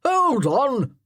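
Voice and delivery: high loud sudden cry; cried out. Acted. Colonel halting Fenella hitting Chatton, loud cry. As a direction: stop them with a shout. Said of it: "cried the colonel".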